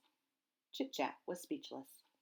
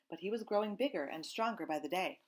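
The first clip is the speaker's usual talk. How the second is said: ordinary delivery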